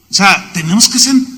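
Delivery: ordinary talk, nothing marked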